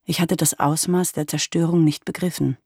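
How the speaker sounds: ordinary delivery, nothing marked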